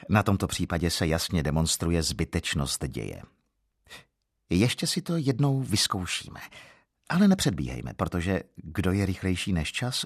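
usual speaking voice

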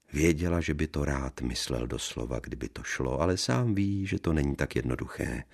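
ordinary delivery, nothing marked

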